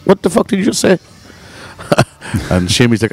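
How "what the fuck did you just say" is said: high-pitched, affronted challenge; imitated tipsy dad. Half-drunk dad to joking friend, high-pitched affronted challenge. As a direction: act the half-drunk father taking offence, pitched high. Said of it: "half in the bag".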